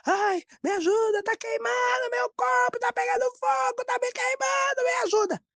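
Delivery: very high-pitched panicked wail for help; imitated screaming. Son flees to the bathroom burning, imitated screaming plea. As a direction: act out burning agony in a high sustained cry. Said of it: "E ele gritava".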